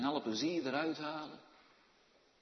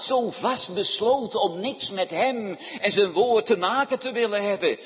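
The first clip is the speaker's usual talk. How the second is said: loud, insistent pulpit voice; impassioned preaching; 6 semitones higher, 10 dB louder than usual. Real, not acted. Preacher warns how far hearts are from God, preaching loudly. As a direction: press it home with raised voice.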